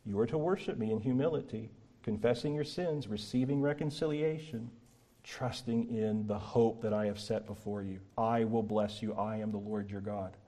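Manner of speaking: hushed, solemn voice of God speaking to his people; solemn quiet God voice. Acted. Preacher voicing God to Israel, hushed and solemn. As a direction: speak low and grave as the Lord.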